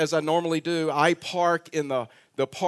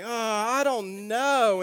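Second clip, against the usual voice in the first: drawn-out, higher, doubtful waver; reluctant hesitation; 5 semitones higher, much slower than usual. Retold or imitated. Preacher retelling his answer to the cart man, hesitant and reluctant. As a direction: stall slowly, acting out your reluctance. Reluctant reply to odd request.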